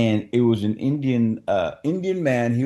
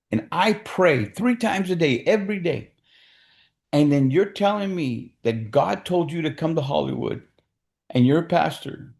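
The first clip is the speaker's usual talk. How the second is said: a scolding son, retold with a raised pitch; lightly voiced indignant son; 6 semitones higher than usual.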